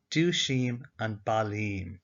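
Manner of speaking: dushim and balim said slowly and deliberately; slow the recap down for learners; slow recap demo. Shown as a demonstration. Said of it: "now we add on our ending".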